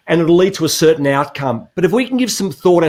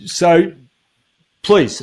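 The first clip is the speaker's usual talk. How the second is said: usual speaking voice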